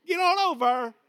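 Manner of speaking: high, loud country Appalachian holler; Big Stone Gap accent. Acted. Preacher mimics a gate announcer for the congregation, loud Big Stone Gap accent. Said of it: "Big Stone Gap".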